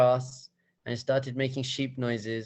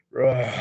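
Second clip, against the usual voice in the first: loud imitated roar; lion roar; 6 dB louder than usual. Shown as a demonstration. Showing that roar from the film, loud roar. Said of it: "It's that roar".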